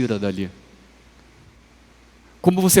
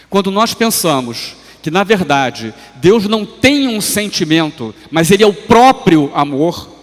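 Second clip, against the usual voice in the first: loud, high, driving sermon delivery; raised pulpit voice, urgent and emphatic; impassioned preaching; 7 semitones higher, 8 dB louder than usual. Real, not acted. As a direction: preach it at full force, hammering key words. Preacher rises to full force.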